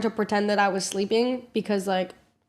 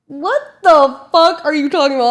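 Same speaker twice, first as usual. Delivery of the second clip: incredulous outburst; 6 semitones higher, 9 dB louder than usual. Retold or imitated. Disbelief at Jason's lie.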